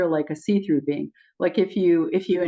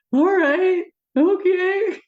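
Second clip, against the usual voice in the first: high, louder, laughing okay; laughing resignation; 13 semitones higher, 3 dB louder, much slower than usual. Retold or imitated. Agreeing to trust the beings, high and laughing. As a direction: give in through laughter.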